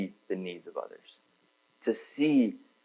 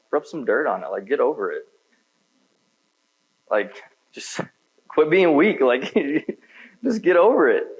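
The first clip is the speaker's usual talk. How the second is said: callous scoff; 6 semitones higher, 4 dB louder than usual. Retold or imitated. Mocking old callous self.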